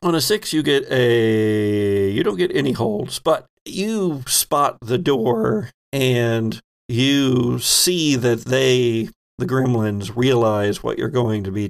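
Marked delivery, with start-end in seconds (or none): none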